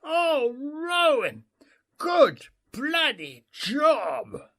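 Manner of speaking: high yell, good stretched slowly; proud father calling to his son far off; screaming praise. A narrator's reading. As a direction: yell it across the water to your son. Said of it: "I screamed over the hundred".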